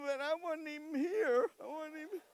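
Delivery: high, hammed-up whimpering protest; husband pleading his innocence; mock whine. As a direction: whine it like a wronged kid.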